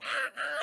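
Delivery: high mock monkey screeches; monkey screech imitation. Zombie monkey messing with her, mock screech. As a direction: do a creepy little monkey's cry. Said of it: "little zombie skeleton monkey".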